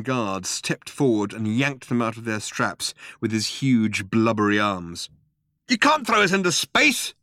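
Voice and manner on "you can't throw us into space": high-pitched yell; yelled protest. A narrator's reading. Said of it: "yelled Ford".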